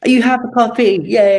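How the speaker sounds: high, loud delighted cheer; thrilled to see the book; excited. Real, not acted. Author delighted to see her book.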